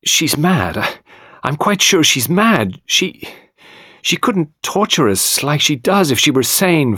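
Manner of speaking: high, agitated, stumbling into a tirade; overwrought, insistent protest; sudden outburst. A narrator's reading. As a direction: burst out and keep the pitch up. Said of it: "Then she broke out suddenly".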